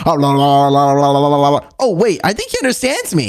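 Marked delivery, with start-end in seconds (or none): 0.1-1.7 low sing-song caveman babble; caveman gibberish. Acted. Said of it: "you do not know how to speak caveman".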